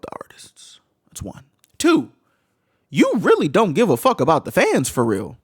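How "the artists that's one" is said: breathy unvoiced accusation; whispered. Real, not acted. Streamer accusing fans of not caring, whispered. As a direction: hiss it at the fans.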